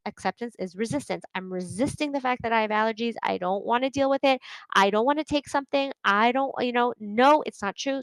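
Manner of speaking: ordinary delivery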